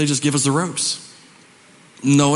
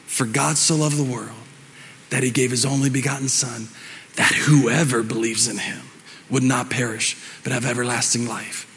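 scripture recitation; 5 semitones lower, 4 dB quieter than usual. Acted.

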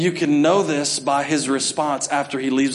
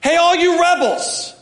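much higher, loud furious taunt; aggressive Moses; 13 semitones higher, 5 dB louder than usual. Retold or imitated. Moses taunting the complaining rebels, furious. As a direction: shout the scorn at the crowd. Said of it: "he's aggressive now".

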